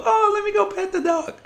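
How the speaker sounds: high, soft falsetto, eager and naive; mock naive kid. Mocking suburban kids who rush to pet any dog, high and soft. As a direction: mimic a clueless kid in falsetto. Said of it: "not gonna be the first person to go".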